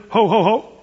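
a light, jolly mock Santa laugh; playful Santa chuckle, barely voiced; Santa's ho ho ho. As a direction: toss off a quick Santa "ho, ho, ho". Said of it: "Following Santa Claus's, uh, incomplete".

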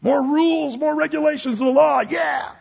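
high, rousing mock rally cry; mock cheering. Preacher parodying a fan of the law, mock-enthusiastic cheer. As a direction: cheer it on with put-on zeal.

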